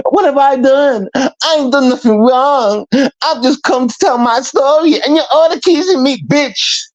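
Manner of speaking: high-pitched mock protest; mock wronged complainer. Caller mimics Kiki protesting on her panel, mock wronged. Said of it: "when I heard you say this".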